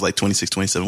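ordinary delivery

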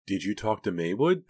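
ordinary delivery, nothing marked

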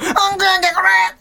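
high, loud laughing yell; excited Kansai yell. Retold or imitated. Mocking how Kansai people greet dog poop, loud laughing yell. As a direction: shriek at the find with glee. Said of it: "関西人がうんこ見て".

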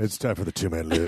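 low, seductive growl; sultry voice. Pretend. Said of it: "I said in a sexy voice".

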